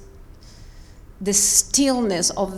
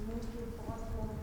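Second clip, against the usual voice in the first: ordinary talk, nothing marked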